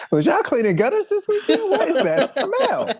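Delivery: high, loud, laughing mock outrage; mock disgusted complaint. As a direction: squeal it in exaggerated disbelief through laughter. Acting appalled housemate reacting to smell.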